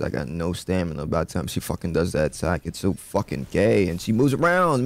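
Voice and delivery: ordinary delivery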